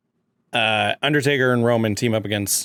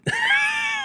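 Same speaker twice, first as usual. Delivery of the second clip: high burst of laughter. Real, not acted. Laughs mid-accent attempt.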